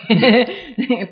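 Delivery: short amused laugh; bright laugh. Real, not acted. Laughs at her busy past.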